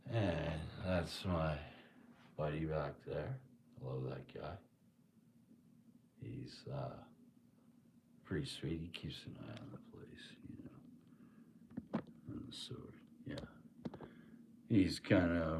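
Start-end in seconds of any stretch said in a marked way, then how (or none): 3.8-14.0 hushed aside about the knight; soft confiding whisper. Real, not acted. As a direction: whisper it like he might overhear. Whispering about knight statue behind.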